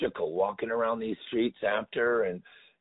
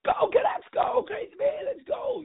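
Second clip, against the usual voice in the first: high, shouted rally cries; imitating kids cheering. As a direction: whoop it up like a kid in the stands.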